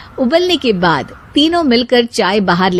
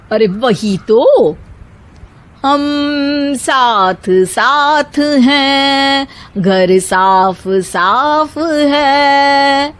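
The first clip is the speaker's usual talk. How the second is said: slow, drawn-out sing-song slogan; sing-song bahu; 4 semitones higher, much slower than usual. A narrator's reading. A bahu recites a cheerful slogan to the others, slow sing-song. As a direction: chant it sweetly.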